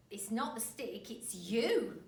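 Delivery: put-on loud, knowing old man; sly husband. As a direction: say it with a smug edge. Husband taunts wife.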